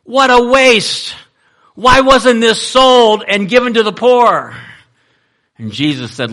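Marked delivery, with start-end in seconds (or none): 0.0-4.5 high, loud indignant protest; upset disciples. Retold or imitated. Disciples object to Mary pouring out perfume, upset and indignant. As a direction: object loudly, pitched well up, outraged at the waste. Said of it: "the disciples were upset".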